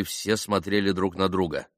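plain reading voice

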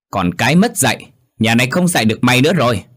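loud, fast disgusted snap; cold contempt. A narrator's reading. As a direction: spit it out in scorn. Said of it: "hử lạnh". (giọng khinh bỉ lạnh lùng)

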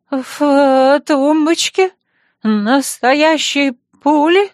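high, loud, slow shaky question; trembling voice. Acted. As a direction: quaver it out in alarm. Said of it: "дрожащим голосом переспросил Арманьяк".

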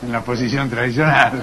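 low droning doctor voice; deep put-on voice. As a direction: intone it like a stuffy old doctor.

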